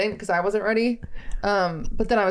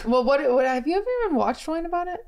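ordinary delivery

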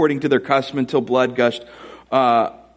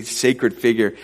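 ordinary talk, nothing marked

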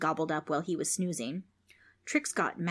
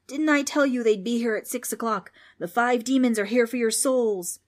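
loud, raised-pitch proclamation; ghost's shout; 4 semitones higher, 5 dB louder than usual. A narrator's reading. Ghost warns of five demons coming for souls, shouting. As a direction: announce doom at full volume. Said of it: "the ghost shouted".

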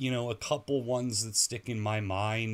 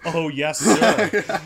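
higher, louder, cheerfully eager reply; mock eager defendant; 7 semitones higher, 12 dB louder, much slower than usual. Host imitating the defendant answering the judge, mock eager. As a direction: chirp it like a proud convict. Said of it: "He's like".

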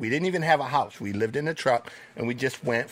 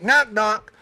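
put-on higher, louder "Knock, knock" sung out like a kid starting a joke; chirpy kid opener "Knock, knock"; friend's knock knock; 8 semitones higher, 5 dB louder than usual. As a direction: classmate eagerly launching a joke. School friends telling a joke.

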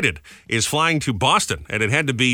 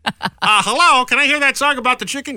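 mock caller; 12 semitones higher, 4 dB louder than usual. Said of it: "caller asking for song".

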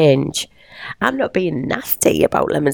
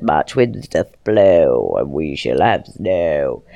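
slow, lower sing-song rhyme; nursery rhyme recital; 11 semitones lower, slower than usual. Pretend.

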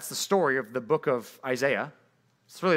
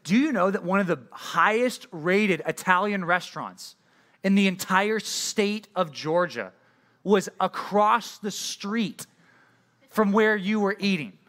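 higher and slowed down, stretching each phrase; incredulous reveal; 5 semitones higher, slower than usual. Retold or imitated. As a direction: rub in the missed chance with mock disbelief. Coworker reveals famous restaurant nearby.

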